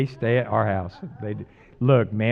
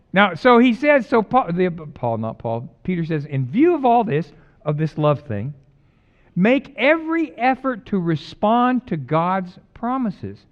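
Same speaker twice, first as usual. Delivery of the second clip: 1 preaching; 7 semitones higher, 6 dB louder, slower than usual. Acted. Preaching at full force.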